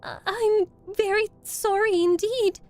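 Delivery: high, loud, stammered apology; frightened little girl. A narrator's reading. Dorothy to the melting witch, frightened apology. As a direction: apologise in a high, scared child voice. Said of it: "who was truly frightened".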